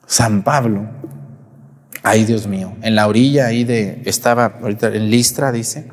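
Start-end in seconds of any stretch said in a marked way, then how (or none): none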